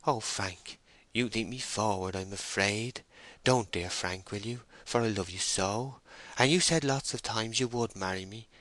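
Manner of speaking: tender female character, close to tears; pleading young woman. Acted. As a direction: beg him gently and earnestly.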